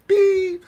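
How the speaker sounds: high, loud alarm beep. Retold or imitated. Censor alarm going off on a cuss, high beep. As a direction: squeal it like a censor bleep. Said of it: "filth alarms".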